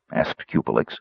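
usual speaking voice

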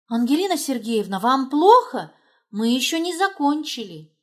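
a concerned outburst, voice lifted; alarmed beautician. A narrator's reading. Beautician rushes in to check on her client, alarmed.

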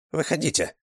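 high-pitched character voice; Chinese man's voice. A narrator's reading. Chinese scout tells passengers to come out, high-pitched voice. Махнул китаец рукой.

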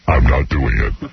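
deep man voice. Pretend. Mocking her refusal in man voice.

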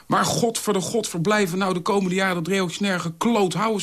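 hoog, luid vloekend; scheldend. A narrator's reading. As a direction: vloek het eruit, woedend. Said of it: "schold Dolf". (boos scheldend, hoge stem)

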